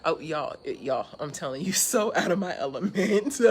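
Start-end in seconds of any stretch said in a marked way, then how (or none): 1.7-3.5 real laugh breaking through the words; giggling, a bit louder. Real, not acted. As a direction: laugh at your own awkwardness. Laughing at own awkwardness.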